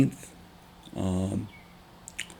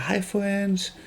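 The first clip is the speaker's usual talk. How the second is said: put-on much higher toddler voice, eager greeting; little son calling out; 12 semitones higher, 4 dB quieter than usual. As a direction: chirp it like an excited small child. Son greeting two little kids.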